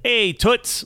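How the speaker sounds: a brash fifties tough guy; greaser tough guy. Retold or imitated. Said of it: "he's like".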